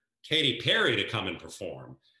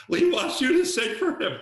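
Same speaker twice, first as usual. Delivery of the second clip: much higher and louder, breaking with emotion; overexcited retelling; 7 dB louder than usual. Retold or imitated. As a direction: blurt the big news almost in tears. Retold tearful phone call, excited.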